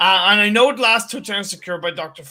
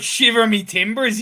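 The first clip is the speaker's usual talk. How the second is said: higher, louder hammy pirate; 6 semitones higher, 4 dB louder than usual. Retold or imitated. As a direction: shout it like a cartoon pirate. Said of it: "That's a pirate voice".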